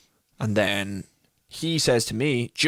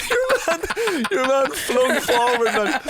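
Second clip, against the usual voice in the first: helpless high-pitched laughter through the words; cracking up; much slower than usual. Real, not acted. Telling co-host about the passenger flung forward, cracking up.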